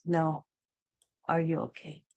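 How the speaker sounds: softly retold, pointed check-in; hushed, meaningful question; knowing, close-up concern. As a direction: lean in close and ask quietly, showing you already know. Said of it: "he said it in such a way I knew".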